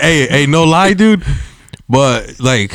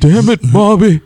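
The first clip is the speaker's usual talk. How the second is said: Hank Hill impression; 9 semitones higher than usual. Quoting King of the Hill, mock Hank yelling at Bobby. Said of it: "propane and propane accessories".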